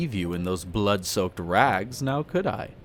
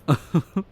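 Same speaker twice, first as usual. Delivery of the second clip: short, higher chuckle; brief laugh, lifting at the end; amused chuckle; 4 semitones higher than usual. Acted. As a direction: chuckle lightly at a naive question. Amused by question about wolves.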